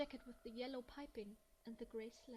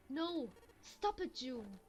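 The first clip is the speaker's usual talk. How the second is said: louder, alarmed shout to stop her; urgent shout; 8 dB louder, slower than usual. Acted. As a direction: yell to stop her hurting herself. Junpei stops June tearing hair.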